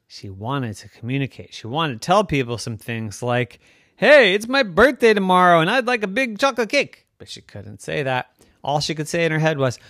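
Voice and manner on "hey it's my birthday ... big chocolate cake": high, loud, bright wish for cake; higher, louder child's birthday wish; excited little girl. A narrator's reading. Yvette's wished-for words voiced.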